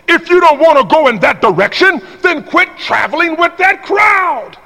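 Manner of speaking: preaching at full force. Real, not acted. Preacher shouting a warning to the congregation, full force. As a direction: preach it hard.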